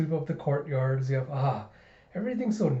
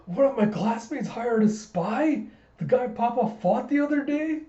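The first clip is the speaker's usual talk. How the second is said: put-on high, shocked little-girl voice; shocked gasp; 10 semitones higher, 3 dB louder than usual. Anya shocked about the spy, high and startled. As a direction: gasp then blurt in disbelief.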